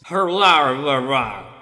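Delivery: gruff henchman grunt, fading low; henchman noises. Shown as a demonstration. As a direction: bark it like a game henchman. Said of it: "I love making henchman noises".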